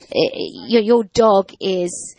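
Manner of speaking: ordinary delivery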